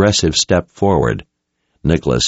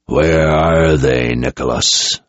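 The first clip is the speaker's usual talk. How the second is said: slow, lower, menacing demand; dangerous low question; 3 semitones lower, slower than usual. Acted. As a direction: press him slowly, barely contained threat. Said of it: "as dangerous sounding a question".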